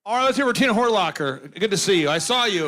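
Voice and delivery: usual speaking voice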